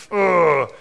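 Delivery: sulky groan; grumbling groan. Retold or imitated.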